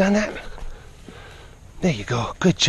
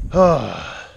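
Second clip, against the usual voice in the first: ordinary talk, nothing marked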